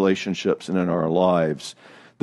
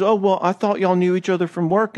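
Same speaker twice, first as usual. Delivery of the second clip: lightly voiced nervous doormat, higher; meek flustered spouse; 7 semitones higher than usual. Shown as a demonstration. Passive spouse over-explaining to controlling partner, timid. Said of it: "Not your typical response, which is".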